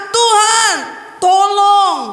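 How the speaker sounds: high, urgent plea; cry for help. Retold or imitated. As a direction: call to God for help. (seruan minta tolong yang tinggi)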